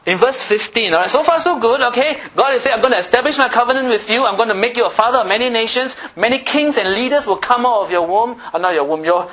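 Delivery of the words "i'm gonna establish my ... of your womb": loud, high preaching of God's promise; preaching at full force. Acted. Preacher voicing God's promise to the congregation, at full force. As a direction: boom it out to the church.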